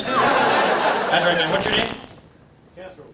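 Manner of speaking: ordinary talk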